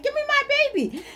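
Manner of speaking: very high, quick wailing cat voice; squealed, hammed-up animal plea; mother cat. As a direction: demand the kitten back as a cat. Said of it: "She was looking at me like".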